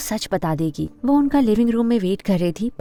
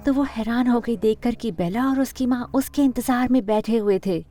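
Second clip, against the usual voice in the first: plain reading voice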